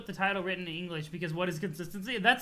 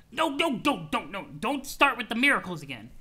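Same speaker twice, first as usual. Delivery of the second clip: irritable troll, exasperated; 3 dB louder than usual. Acted. Troll begs friend to drop the miracles, exasperated. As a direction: cut him off in acted exasperation.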